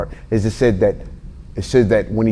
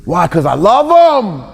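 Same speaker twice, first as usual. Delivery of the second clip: mushy dad pleading; 7 semitones higher than usual. Retold or imitated.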